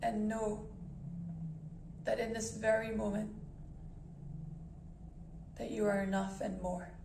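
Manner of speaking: calm guided meditation voice with long pauses. Acted. Meditation host guiding listeners to breath and body, calm and measured. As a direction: ease listeners into stillness, unhurried and even.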